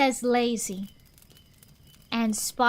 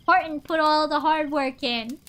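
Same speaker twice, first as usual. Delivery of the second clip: usual speaking voice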